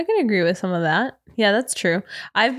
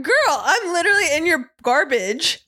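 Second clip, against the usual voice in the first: sassy retort; 7 semitones higher than usual. Retold or imitated. Mock retort to messy driver.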